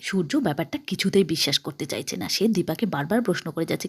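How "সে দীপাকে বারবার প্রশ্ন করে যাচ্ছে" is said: ordinary delivery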